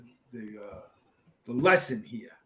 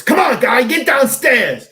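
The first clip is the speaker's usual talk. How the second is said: much higher, loud barked order; retold shout; 9 semitones higher, 11 dB louder than usual. Chief shouting at firefighter to get downstairs, loud. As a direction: bark it like a chief yelling down the line.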